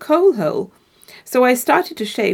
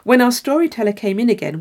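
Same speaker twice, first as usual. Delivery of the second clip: ordinary delivery, nothing marked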